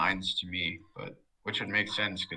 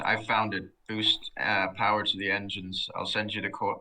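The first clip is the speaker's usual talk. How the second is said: ordinary delivery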